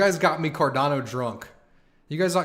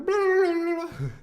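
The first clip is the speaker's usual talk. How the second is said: mock blah blah; 4 dB louder, slower than usual.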